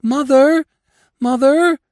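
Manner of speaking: high, loud wailing cry; wailing call. Acted. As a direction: call out desperately for mother. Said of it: "they called".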